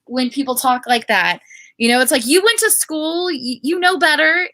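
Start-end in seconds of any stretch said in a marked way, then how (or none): 1.8-4.5 raised, higher voice chiding someone who should know better; a fed-up "come on, you know better"; exasperated scolding. Acted. Reader scolding the author over slang, exasperated.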